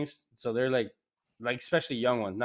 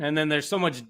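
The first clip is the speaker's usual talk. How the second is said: ordinary talk, nothing marked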